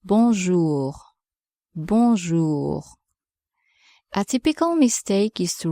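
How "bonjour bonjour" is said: "Bonjour" ending in a gargled French r; throaty French r. Shown as a demonstration. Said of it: "gargling type sound in the throat".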